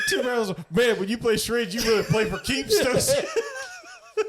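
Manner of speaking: high, strained comic character voice; Ernest. Retold or imitated. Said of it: "Ernest goes".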